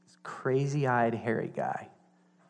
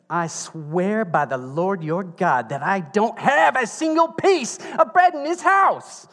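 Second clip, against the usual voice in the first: slow oath rising much higher at the end; indignant widow; 6 semitones higher, 5 dB louder, slower than usual. Retold or imitated. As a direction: swear it slowly, then climb high and outraged. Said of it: "this is how she said it".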